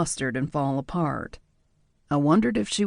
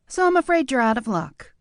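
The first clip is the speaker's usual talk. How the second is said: higher, louder playful tease; higher-voiced mock pity; teasing woman; 9 semitones higher, 4 dB louder than usual. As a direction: tease him with mock regret. Nadia teasing.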